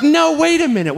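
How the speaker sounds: high-pitched acted plea to wait; imitated urgent interruption. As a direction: stop someone from walking out. Gymnast urgently stops him leaving.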